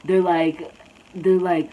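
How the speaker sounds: normal talking voice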